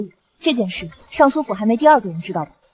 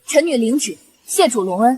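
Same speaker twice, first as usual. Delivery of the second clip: normal talking voice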